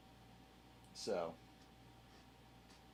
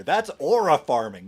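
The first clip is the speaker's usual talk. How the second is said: excited; 4 semitones higher, 11 dB louder than usual. Real, not acted. Excited reaction to a clip.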